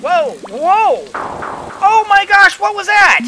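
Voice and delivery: high, loud startled whoa; suddenly shocked, voice jumping up. Real, not acted. Fish suddenly bounces wildly.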